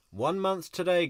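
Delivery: high, loud excited announcement. Real, not acted. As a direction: hype it up for viewers. Announcing trial date countdown.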